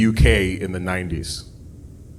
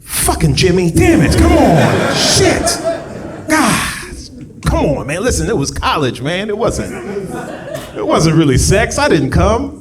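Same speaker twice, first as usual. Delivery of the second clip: much higher and louder, frantic pleading; panicked guy; 4 dB louder than usual. Acted. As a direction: beg desperately, voice cracking up high. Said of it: "they're like".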